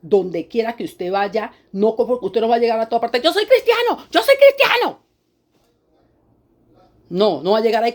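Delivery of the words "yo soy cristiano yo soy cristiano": much higher, louder whiny mock voice; mocking boaster. Preacher mocks a believer boasting of faith, whiny high parody. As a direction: parody a self-important churchgoer bragging.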